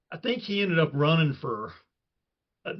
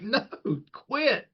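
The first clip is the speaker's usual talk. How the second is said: high-pitched mock protest; Cowher; 9 semitones higher than usual. Imitating coach telling leaping player off, high mock scolding. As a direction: call it out high, like a fed-up coach. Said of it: "And he's like".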